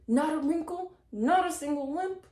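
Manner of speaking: loud high furious yell; the cruel queen screams at the girls. A narrator's reading. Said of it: "She yelled".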